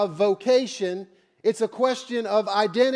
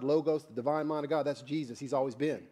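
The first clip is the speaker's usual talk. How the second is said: usual speaking voice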